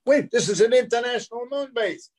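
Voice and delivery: high-pitched, insistent objection; mock protest. Lee stopping the actor at the door, high insistent protest. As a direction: cut in with a high, urgent protest. Retold interruption at the audition.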